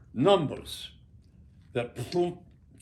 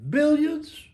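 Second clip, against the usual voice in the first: sudden high, loud outburst; burst of awe; 6 dB louder than usual. Real, not acted. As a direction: let the word explode out at the flash. Blinding flash of the bomb.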